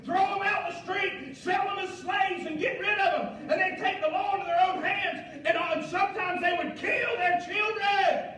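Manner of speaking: loud, high-pitched impassioned preaching; preaching at full force. Real, not acted.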